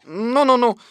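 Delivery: lightly voiced reproving rabbit; mild admonishing. A narrator's reading. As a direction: step in to calm the chatter. Rabbit hushes the excited chatter.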